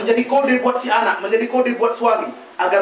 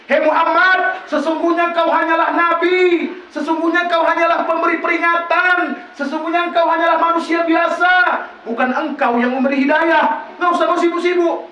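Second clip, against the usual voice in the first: proclaiming; 7 semitones higher than usual. Retold or imitated. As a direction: proclaim it with full force. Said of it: "kata Allah gitu".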